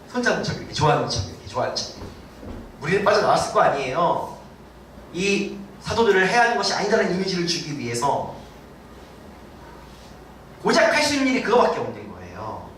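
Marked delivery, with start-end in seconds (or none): none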